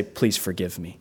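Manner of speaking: soft, quiet "Please forgive me"; humble plea. Retold or imitated. Pastor voicing a hard apology to someone, soft and humble. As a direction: ask forgiveness humbly, barely above a hush. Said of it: "than to say".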